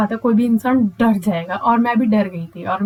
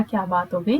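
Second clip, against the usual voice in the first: asking the stranger gently what happened; sweetly, lovingly; 4 semitones lower, 5 dB quieter than usual. Retold or imitated. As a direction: lower and softer, gently asking. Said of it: "मैं भी बड़े प्यार से बोल रही थी".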